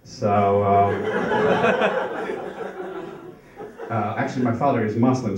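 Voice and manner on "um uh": usual speaking voice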